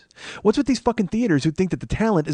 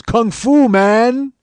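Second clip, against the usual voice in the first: higher, louder imitated cab driver exclaiming; cab driver's catchphrase; 8 semitones higher, 8 dB louder, much slower than usual. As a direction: belt it like a proud catchphrase. Cab driver voiced striking a pose.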